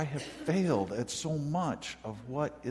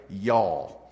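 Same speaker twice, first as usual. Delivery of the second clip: Southern y'all; 4 semitones lower, 5 dB louder than usual. Shown as a demonstration. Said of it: "You know that expression".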